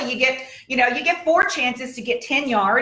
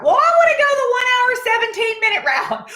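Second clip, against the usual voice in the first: much higher and louder, a playful squeak; mock high voice; 13 semitones higher, 4 dB louder than usual. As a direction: pipe up like a chirpy kid picking the route. Answering the phone's choice.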